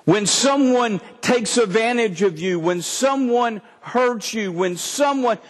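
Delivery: usual speaking voice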